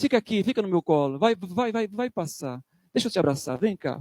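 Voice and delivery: bem mais baixo, como ninar uma criança; faça um consolo de colo, meloso; consolo terno fingido. Pretend. (Jesus imaginado ninando os discípulos, baixinho e terno)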